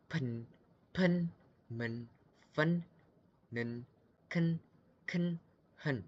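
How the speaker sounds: teacher reading pinyin syllables one at a time; crisp, separate syllables; pinyin drill. Shown as a demonstration. Teacher drilling students: pen, men, fen. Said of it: "kita coba pelan-pelan ya".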